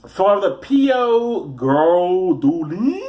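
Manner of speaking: drawn-out, hammed-up Italian name; mock Italian, last syllable held long; mock Italian accent. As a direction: stretch it slowly in fake Italian.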